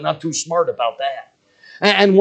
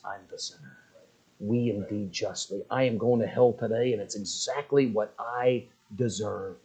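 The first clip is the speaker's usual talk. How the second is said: hushed confession; 6 semitones lower, 8 dB quieter than usual. Retold or imitated. The thief admitting he deserves hell, soft and low. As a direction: confess it quietly, head bowed.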